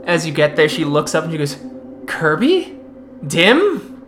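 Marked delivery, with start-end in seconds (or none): 2.1-4.0 high questioning disbelief; surprised recognition. Acted. Captive looks up and sees her friends, surprised. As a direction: ask each name as if astonished.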